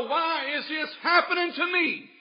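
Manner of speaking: slow, high, loud lament; a hurt plea, slow and high; Gideon's lament. Retold or imitated. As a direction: stretch out the why in anguish. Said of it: "That's what Gideon's saying".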